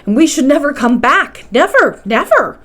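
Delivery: mildly voiced dread, swelling at the end; agitated. A narrator's reading. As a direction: panic rising at the thought of never returning. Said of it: "I was in a state of indescribable agitation".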